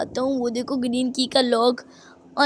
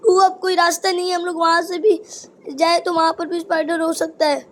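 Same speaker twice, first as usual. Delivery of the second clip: ordinary delivery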